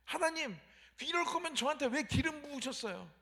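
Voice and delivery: pleading with rising complaint; complaining prayer. Retold or imitated. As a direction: protest to God, asking why. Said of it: "이렇게 얘기할 만도 해요".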